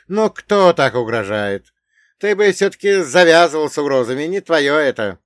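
loud, high scolding jeer; exclaiming dwarf. Acted. Dwarf ridiculing his captor's threats, loud exclaiming. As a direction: scoff loudly at the threat. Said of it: "воскликнул карлик".

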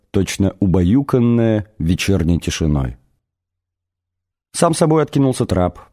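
ordinary delivery, nothing marked

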